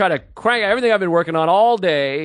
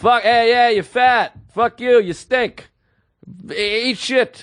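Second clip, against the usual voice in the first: mock heckler yell; 7 semitones higher, slower than usual. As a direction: bark insults like a rowdy male heckler. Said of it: "they go".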